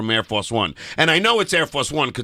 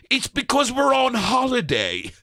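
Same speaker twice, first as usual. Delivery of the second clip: high, slow fake British accent; bad English accent; 8 semitones higher, slower than usual. Prank caller excusing the area code, in a bad English accent. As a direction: put on a poor English accent, stretched out. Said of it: "I did the worst English accent known to man".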